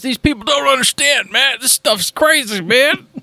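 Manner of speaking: hammed-up exasperated whine; mock desperation. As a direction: play up the overwrought complaint, drawing out the ending. Mock complaint about podcasters' hardship.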